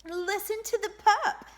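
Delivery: usual speaking voice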